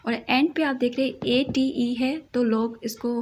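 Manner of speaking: normal talking voice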